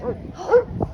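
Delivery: excited gasp. Shown as a demonstration. Excited reward to dog.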